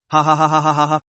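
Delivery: hearty laughing ha ha ha; group laugh. A narrator's reading. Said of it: "A few people laughed out at the same time".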